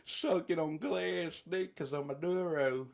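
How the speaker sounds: high, slow hammed-up Arkansas drawl; mocking put-on Arkansas voice; Tom Cotton. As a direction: stretch it out like an outraged Southern politician. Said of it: "you got Tom Cotton out there in Arkansas saying".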